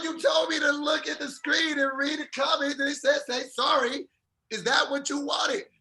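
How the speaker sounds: high, insistent self-defence; retold exasperated protest. Husband retells protesting to his wife about sorry, exasperated.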